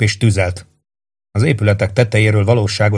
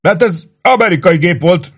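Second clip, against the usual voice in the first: high-pitched, dismayed protest; narrated helpless disbelief; 9 semitones higher than usual. A narrator's reading. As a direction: say it at a loss, pitch raised. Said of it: "mondta gyámolatlanul".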